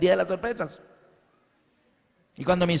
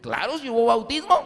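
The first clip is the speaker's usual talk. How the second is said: insistent retort; 5 semitones higher than usual. Retold or imitated. Arguing with friend.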